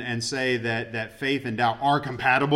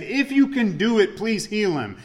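desperate plea; 7 semitones higher than usual. Retold or imitated. Desperate father pleading.

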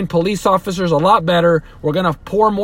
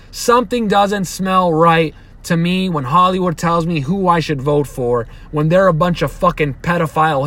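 normal talking voice

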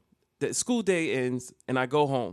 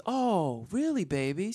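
higher-pitched grandma, drawing out the "oh"; feigned innocence; 5 semitones higher, slower than usual. Retold or imitated. Grandmother stalling before an instant lie, feigned surprise. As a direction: play innocent while covering up. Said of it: "instant lie".

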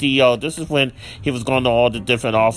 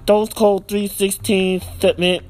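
eager quiz answer voice; 8 semitones higher than usual. Retold or imitated. Retelling a character fumbling an answer.

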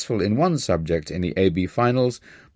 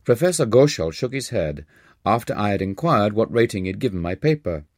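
ordinary delivery

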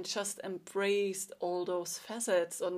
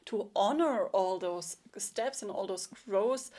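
ordinary talk, nothing marked

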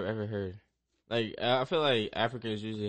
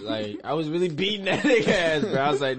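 high, loud laughing; 6 semitones higher, 8 dB louder than usual. Real, not acted. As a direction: laugh through the words. Laughing while telling.

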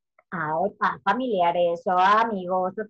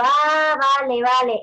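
loud "Ah" then falling "vale, vale"; own catchphrase; 3 semitones higher, 7 dB louder than usual. Retold or imitated. Teacher telling a mother "Ah, vale, vale", loud casual agreement. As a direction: burst out "Ah, vale, vale", "Ah" louder and higher. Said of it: "estaba hablando yo con la madre y digo".

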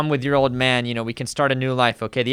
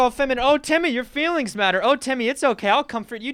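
mock coddling mother; 11 semitones higher than usual.

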